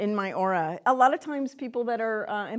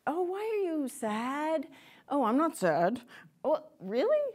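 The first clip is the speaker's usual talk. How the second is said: put-on higher, slow, wide-eyed child's question; child asking parent; 6 semitones higher than usual. Small child asking mom why she's sad, higher and slow. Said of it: "and to try to figure out".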